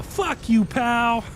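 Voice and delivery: high mock insult; imitating a comedian's catchphrase; quoting the catchphrase. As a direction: toss off the borrowed line. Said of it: "that's where I got the".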